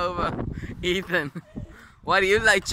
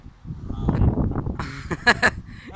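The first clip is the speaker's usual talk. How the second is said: breathy laugh; 2 semitones lower than usual. Real, not acted. Friends laughing at challenge.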